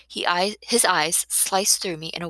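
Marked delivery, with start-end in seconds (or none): none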